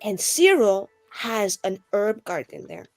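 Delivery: normal talking voice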